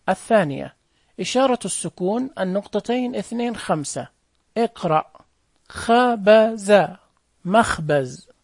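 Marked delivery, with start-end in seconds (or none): 5.7-6.9 خبز drawn out syllable by syllable; sounded out slowly. Shown as a demonstration. Teacher reading to first graders, sounded out slowly. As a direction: stretch each syllable of خبز evenly.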